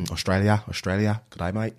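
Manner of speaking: halting attempt at broad Aussie; Australian accent. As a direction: feel your way through an Australian accent. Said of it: "Australia and say".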